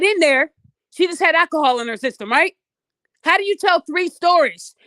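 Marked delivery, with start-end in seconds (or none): none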